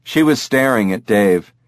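ordinary delivery

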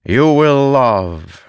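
high, drawn-out, haunting pronouncement. A narrator's reading. A spirit foretells Dalinar's life, haunting. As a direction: intone it slowly like a spirit. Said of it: "the haunting voice continued".

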